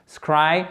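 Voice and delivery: Scry stressed, with a rising end; central syllable alone. Shown as a demonstration. Teacher drills the central syllable, stressed rising Scry. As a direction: isolate Scry with a full eye vowel. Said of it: "votre syllabe centrale pour la prononciation".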